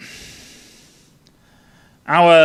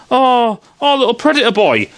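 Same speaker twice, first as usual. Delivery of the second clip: put-on higher, quick sing-song coo at a baby; mock cooing; 7 semitones higher than usual. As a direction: coo over the little runt, hammed-up and high.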